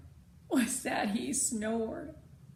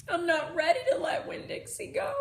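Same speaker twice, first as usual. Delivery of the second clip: louder, higher, tearful; crying; 7 semitones higher, 7 dB louder than usual. Acted. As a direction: choke it through tears. Girl cries over her dog.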